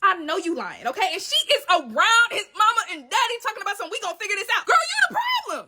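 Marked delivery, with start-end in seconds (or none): 3.9-5.7 high outraged shout; scandalized shout. Real, not acted. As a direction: yell it at her in disbelief. Mocking then accusing Mercedes.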